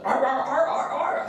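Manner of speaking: high seal barks in quick bursts; high-pitched mock seal voice; barking seal. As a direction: bark it out like a seal. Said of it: "the seal's like".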